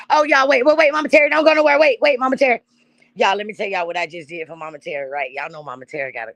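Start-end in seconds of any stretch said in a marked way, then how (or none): 0.1-2.6 high, loud urgent call to stop her leaving. Real, not acted. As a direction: yell wait after her before she goes. Calling Mama Terry back urgently.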